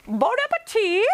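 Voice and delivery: high, fluting celebrity TV chef impression; Julia Child. Shown as a demonstration. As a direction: sing it out way up high like a grand cookery host. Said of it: "I'll say it myself".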